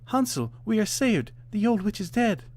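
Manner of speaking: high excited call to her brother; joyful call. A narrator's reading. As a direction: burst out with relief. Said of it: "called out".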